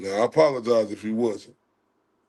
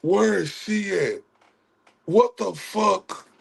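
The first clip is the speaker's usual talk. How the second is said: higher-pitched, drawn-out indignation; exasperated venting; 6 semitones higher, slower than usual. Real, not acted. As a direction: demand answers in exasperation, stretching the words. Venting at her absence.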